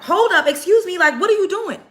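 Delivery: high, loud, outraged "what are you doing"; mock-furious homeowner; indignant confrontation. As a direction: retell her demanding answers from the intruder. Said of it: "She's like".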